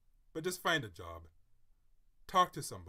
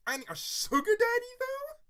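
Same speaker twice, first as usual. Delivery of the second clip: incredulous; 6 dB louder than usual. Real, not acted. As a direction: pitch shooting up on sugar daddy. Incredulous outburst at the idea.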